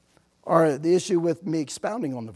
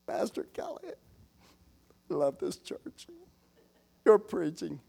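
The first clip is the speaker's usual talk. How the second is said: gushing churchgoer; 5 dB quieter than usual. Retold or imitated. Woman gushing, retold whisper.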